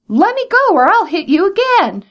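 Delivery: high, loud furious shout. A narrator's reading. Brer Rabbit stuck in tar, shouting at the tar baby. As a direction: yell it while stuck fast. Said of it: "shouted Brer Rabbit".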